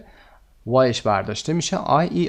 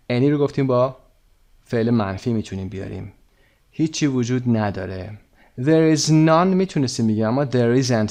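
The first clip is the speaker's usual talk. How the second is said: ordinary talk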